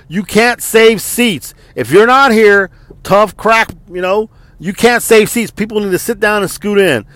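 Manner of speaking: imitating a captain laying down the rule; barking order. Captain ordering the crowd, mock drill-sergeant bark.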